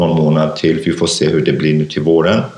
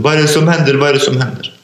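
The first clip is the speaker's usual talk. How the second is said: frightened voice; 8 semitones higher, faster than usual. Retold or imitated. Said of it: "Jag hör en röst också i bakgrunden".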